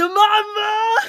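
put-on high, loud call of a scared child; panicked cry for mum. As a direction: shriek for mum as if running for your life. Kind ruft panisch nach Mama. (hoher, lauter Schrei nach Mama)

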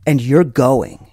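low, slow solemn pronouncement; judge-like seriousness. Retold or imitated. Stranger pointing right at the teller, gravely serious. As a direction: hand it down like a sentence. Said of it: "with the seriousness of a Supreme Court judge".

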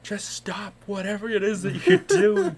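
very high, loud, imitated plea; hammed-up shrieky exasperation; exasperated wife. Exasperated wife pleading, retold.

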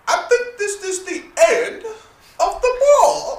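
very high, slow theatrical announcement; Lady Danbury. Retold or imitated. Host mimics TV lady ending the ball, high theatrical voice. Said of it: "the Lady Denver was like".

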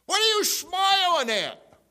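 drawn-out, high mock roar; judge blowing up. Judge to the half-smiling sheriff, angry drawn-out yell. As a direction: bellow it like an outraged judge. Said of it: "he's just blowing me away".

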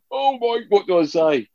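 very high, flustered squeak; imitating his own starstruck dread; mock panic. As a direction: act out frozen nerves in front of a hero. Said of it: "I was like".

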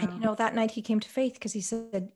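ordinary delivery

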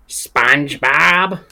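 slow, first-try Squidward voice; a tentative stab at Squidward; Squidward impression. First try at Squidward for a friend, slow mock voice. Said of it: "it's pretty decent".

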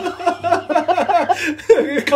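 high bright burst of laughter; giggle. Real, not acted.

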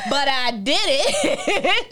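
slow, drawn out through laughter; laughing admission. Real, not acted. Host admitting she went back anyway, laughing. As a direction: own up to it, cracking up.

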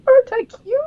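very high, cutesy little squeal; Queen Beryl. Retold or imitated. Fan mimics the villain bouncing round her palace, playfully cutesy. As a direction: put on a sugary, sky-high show-off voice.

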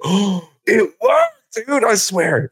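high, slow astonished cry; amazed. Retold or imitated. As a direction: marvel at it, stretched out. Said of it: "And I go".

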